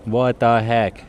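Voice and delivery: normal talking voice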